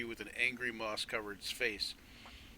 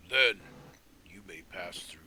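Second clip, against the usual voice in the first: tree giant's words; 4 semitones lower, 7 dB louder than usual. Acted. Tree creature grants passage.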